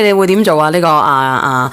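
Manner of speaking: usual speaking voice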